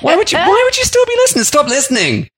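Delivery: high-pitched disbelief, ranting; mock outrage at listeners; exasperated rant. Real, not acted. Ranting at one-star reviewers.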